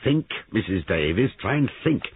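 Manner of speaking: high, insistent prompting; urgent questioning; pressing interrogator. A narrator's reading. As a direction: push the witness for an answer, option by option. Sergeant presses a shaken witness.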